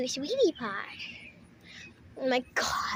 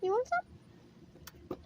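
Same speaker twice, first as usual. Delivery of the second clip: coaxing kitten voice; 10 semitones higher, much slower than usual. Acted.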